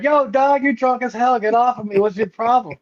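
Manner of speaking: put-on high, indignant shout at a drunk; annoyed yell. Tent collapsing on him, yelling at the supposed drunk, high and indignant. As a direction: tell off the guy falling on you.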